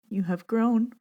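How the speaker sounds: choked up on the word; tears catching her voice; moved, barely holding it together; voice breaking. Real, not acted. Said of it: "Oh, gets me every time".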